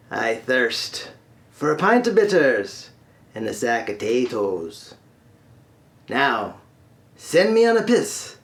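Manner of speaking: put-on feisty Irish accent, swinging up on demands; Irishman's accent. Irishman grudgingly makes his wish to the genie, feisty Irish accent. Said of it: "So the Irishman".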